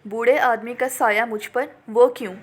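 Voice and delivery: usual speaking voice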